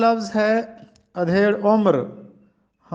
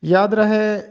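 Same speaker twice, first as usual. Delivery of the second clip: usual speaking voice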